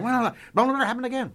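high, fast mock scolding; mock network scold. Quoting the network's stern scolding, mock high voice.

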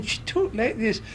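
high, silly showgirl voice; affected high-pitched feminine impression. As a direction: imitate her sober voice, pitched right up. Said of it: "the moment she was sober again".

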